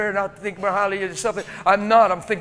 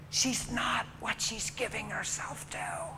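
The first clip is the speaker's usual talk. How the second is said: soft whisper, slow; 9 dB quieter than usual. Real, not acted. Preacher quietly tells congregation, whispered. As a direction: breathe it out as a confidence.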